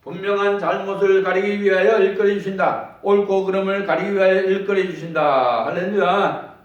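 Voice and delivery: loud, ringing sermon cadence; fervent preacher in full voice; preaching. Acted. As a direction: preach it out to the congregation. Pastor preaching to congregation.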